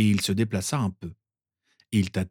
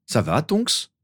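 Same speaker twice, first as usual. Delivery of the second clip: higher, rising question of concern; concerned Harry; 5 semitones higher, slower than usual. A narrator's reading. Boy asks after an injured friend, concerned. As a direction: check on a hurt friend, mildly worried. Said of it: "s'inquiéta Harry".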